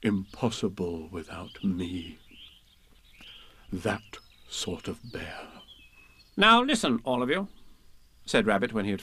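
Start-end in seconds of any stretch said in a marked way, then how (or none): 0.0-5.5 low, soft, slow private pride; proudly to himself. A narrator's reading. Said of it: "said proudly to himself". 6.4-7.5 high, brisk Rabbit calling attention; rally the group to listen; Rabbit, commanding. A narrator's reading. Rabbit voiced.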